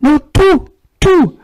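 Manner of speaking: high, loud burst of insistence; emphatic insistence. Real, not acted. To the audience, insisting that everything can be blessed, emphatic and high. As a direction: cut off the doubt, everything.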